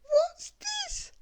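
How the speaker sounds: very high squeaky little voice; Little Miss Tiny. Acted. Little Miss Tiny finding a bandage, very high squeak. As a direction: squeak it like someone very small.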